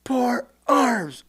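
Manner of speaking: high, strained distant command; drill instructor voice. Retold or imitated. Drill instructor calling to recruit out at night, mimicked command voice. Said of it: "in a drill instructor voice".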